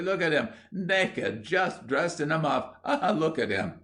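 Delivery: high voice breaking into laughter; mirthful jeering. A narrator's reading. Boy jeers at William in the ditch, mirthful. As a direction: laugh through the mockery. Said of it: "he shrieked mirthfully".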